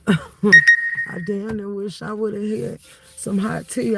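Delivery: normal talking voice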